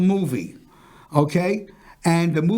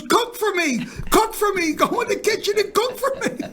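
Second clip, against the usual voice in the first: demanding wife; 13 semitones higher than usual. Retold or imitated. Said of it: "She goes".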